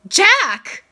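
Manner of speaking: high, loud reproachful cry of the name; shocked mother calling him out; sharp scolding Jack. Acted. Mom scolding her son in the principal's office, loud sharp Jack.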